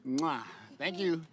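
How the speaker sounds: high, sing-song voice for toddlers. Real, not acted. Father talks to toddler daughters.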